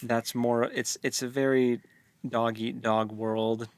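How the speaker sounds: ordinary talk, nothing marked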